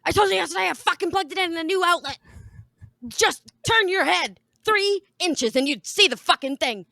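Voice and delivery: loud, fast, high furious outburst; wife's imagined rant at husband, furious yelling; imagined angry rant. Retold or imitated. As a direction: let the fury fly at full speed. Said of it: "in my head, I said".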